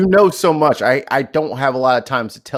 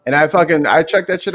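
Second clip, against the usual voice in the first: ordinary talk, nothing marked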